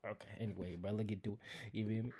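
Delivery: usual speaking voice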